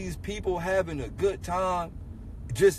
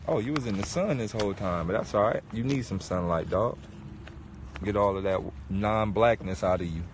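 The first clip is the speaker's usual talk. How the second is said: teasing street talk; 10 semitones lower, 5 dB quieter, faster than usual. Pretend.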